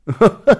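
loud, high mocking laugh burst; lion's hearty laugh. Lion mocking the wolf's question, loud hearty laugh.

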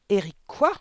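loud, incredulous bark; bawled question. A narrator's reading. Headmistress doubts the boy's surname, bawling. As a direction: bawl it at the pupil. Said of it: "brailla mademoiselle Le Gourdin".

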